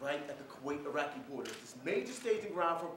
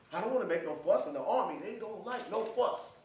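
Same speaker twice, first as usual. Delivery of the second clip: loud, raised, forceful insistence; insistent; 6 semitones higher, 8 dB louder than usual. Acted. Soldier hiding his illness from Army, insistent. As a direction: hammer it out as a protest.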